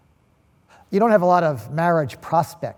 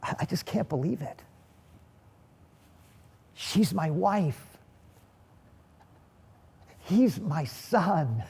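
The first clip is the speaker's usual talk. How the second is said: softly, slowly marvelling; soft, halting voice of an awed new father; hushed disbelief; 5 dB quieter, slower than usual. Acted. New father marvelling at wife, son.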